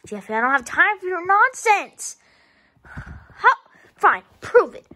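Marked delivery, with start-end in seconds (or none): none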